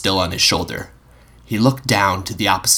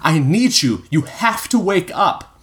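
1 urgent plea; 10 semitones higher than usual. A narrator's reading. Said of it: "he shouted".

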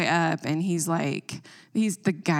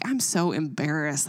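usual speaking voice

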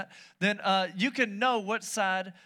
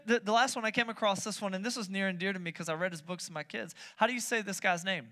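ordinary delivery

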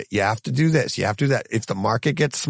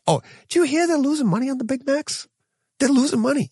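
high, put-on gossipy voice; mock gossip; 10 semitones higher, faster than usual. Host mocking people who fuss over profits, mock gossip voice. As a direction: play a nosy gossip about burgers.

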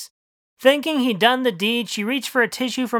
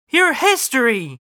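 slow, loud mock scream; 5 semitones higher, 4 dB louder than usual. Wife screams at husband about his past, slow and loud. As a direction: hurl it slowly at him. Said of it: "screamed Marianne".

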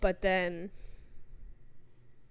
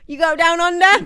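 loud, high laughing Australian; joking Aussie through laughter; mock Aussie accent; 9 semitones higher, 13 dB louder than usual. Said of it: "Aussie bit continues".

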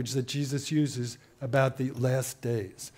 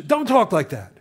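higher, louder mock rebuke; retold scolding; 4 semitones higher, 6 dB louder than usual. Adult answers the frightened child, retold scolding. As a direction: act out an adult shutting a child up.

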